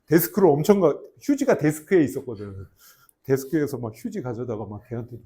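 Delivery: normal talking voice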